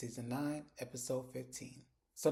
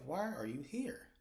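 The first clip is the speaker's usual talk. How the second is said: slow, higher-pitched, pointed question; retold exasperated question; 4 semitones higher, slower than usual. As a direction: spell it out slowly to an unwelcome mom. Daughter demands reason for visit.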